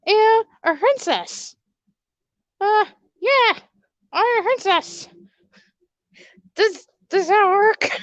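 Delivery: high, sweet princess voice, unsure of herself. Acted. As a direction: play a shy princess, pitched well up. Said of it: "why is her name Princess Eve".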